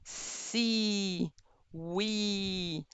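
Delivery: "C, we" with wide smiling lips; smiling E sound. Shown as a demonstration. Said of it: "you need to make that smiling movement".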